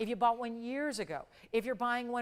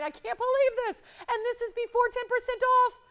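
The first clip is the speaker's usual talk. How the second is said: much higher, louder, giddy disbelief; amazed excitement; 13 semitones higher, 8 dB louder, slower than usual. Real, not acted. Host telling viewers about the price, giddily amazed and high.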